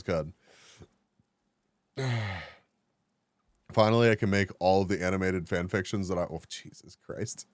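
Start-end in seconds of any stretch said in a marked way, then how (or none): 6.2-7.5 whispered under her breath, slowly; whispered aside. Real, not acted. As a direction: breathe it out, embarrassed. Embarrassed aside to herself.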